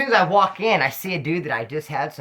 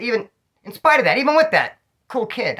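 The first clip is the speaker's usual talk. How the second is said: emphatic aside; 7 semitones higher, 4 dB louder than usual. Real, not acted. Emphatic aside about the kid.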